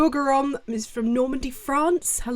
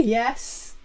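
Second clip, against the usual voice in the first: ordinary talk, nothing marked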